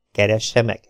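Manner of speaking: plain reading voice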